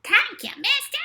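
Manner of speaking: very high, loud squawky puppet duck; boy's duck puppet echoing him, shrill. A narrator's reading. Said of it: "and his duck said".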